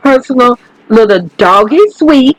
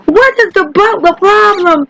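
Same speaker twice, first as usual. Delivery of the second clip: high laughing words; tickled, laughing about the butler; 8 semitones higher than usual. Real, not acted. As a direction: laugh through the line in disbelief.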